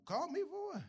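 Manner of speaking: high, puzzled demand; incredulous. Retold or imitated. Pastor questioning a member who only called, incredulous. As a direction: throw the question back in disbelief.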